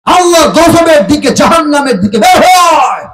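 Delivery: high, loud order to come out; threatening rebuke. Acted. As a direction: shout as the angel of death summoning a sinner. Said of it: "মালাকুল মউত ধমক দিয়ে বলবে".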